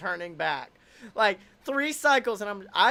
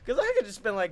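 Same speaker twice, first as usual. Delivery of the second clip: ordinary talk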